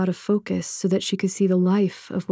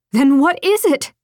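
higher, louder demanding question; 7 semitones higher, 5 dB louder than usual. Acted. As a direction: press him hard for an answer. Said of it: "she demanded".

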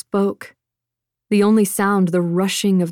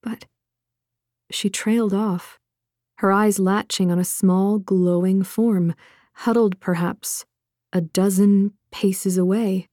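ordinary delivery, nothing marked

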